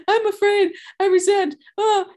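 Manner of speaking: high, whiny mock distress; mock whimpering. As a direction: parody a tearful complaint. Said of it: "call another compulsive overeater and say".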